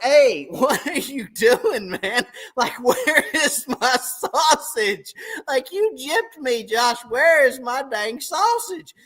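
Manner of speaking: high, slow, laughing mock outrage. Complaining to friend about sausage.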